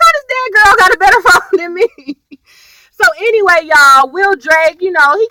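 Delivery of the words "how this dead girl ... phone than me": laughing disbelief. Retold or imitated. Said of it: "I'm like".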